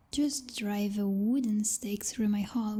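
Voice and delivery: ordinary talk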